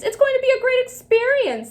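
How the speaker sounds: high, sing-song mocking voice; mocking adults. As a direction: parody the cheery adults. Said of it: "they said".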